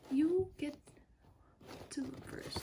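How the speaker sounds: hushed teasing whisper; whispered tease. Acted. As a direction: breathe it like a secret. Teasing viewers before reveal.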